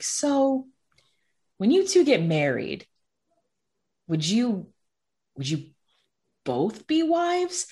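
halting question, long pauses, rising end; tentative asking. Retold or imitated. Dad asking his child about marriage terms, hesitant and tentative. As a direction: feel out the wording, stretching the last words high.

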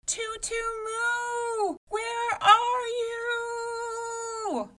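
very high, very slow, crying shout; desperate call. A narrator's reading. Mother at the dead giant, crying out for daughter. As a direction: cry out her name in despair. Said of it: "she called one last time".

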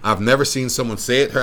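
usual speaking voice

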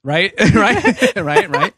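high, loud laughing rights; laughing agreement. Real, not acted. Host laughs along with guests, repeating right. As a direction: laugh through the words, egging them on.

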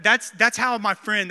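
ordinary talk

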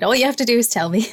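ordinary delivery

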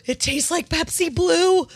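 high, loud excited gasp. Real, not acted. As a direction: gasp it out in delight. Excited discovery of the taste.

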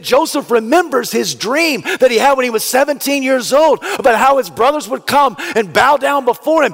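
high, loud, building sermon delivery; preacher building to a shout; preaching. Acted. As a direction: drive the point home to the congregation. Preaching climax.

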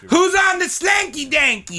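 high announcer shout; announcer call. Acted. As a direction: call it out like a host. Announcing the show segment.

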